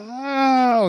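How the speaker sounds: a sudden high, loud yelp of shock; startled cry. Retold or imitated. Stabbing victim caught by the knife, a high startled yelp. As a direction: cry out as if just cut by the blade.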